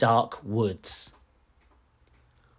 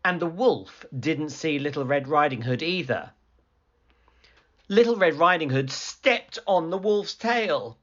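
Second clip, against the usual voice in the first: ordinary delivery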